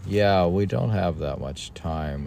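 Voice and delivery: ordinary talk, nothing marked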